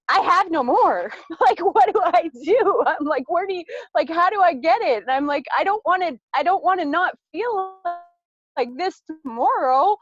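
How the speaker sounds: high, loud, half-laughing panic; mock panic. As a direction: replay the frantic worry with a laugh. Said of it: "And then I got scared".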